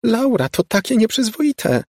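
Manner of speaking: very high gleeful squeal; high scandalised squeal. A narrator's reading. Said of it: "piszczała".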